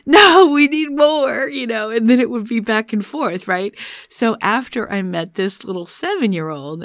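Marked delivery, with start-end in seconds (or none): 0.0-1.5 louder, higher, drawn-out mock protest; high, loud, slow insisting; insistent inner part. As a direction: play the demanding side of an inner argument. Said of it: "the other part of me would be like".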